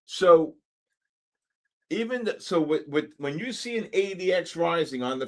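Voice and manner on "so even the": ordinary talk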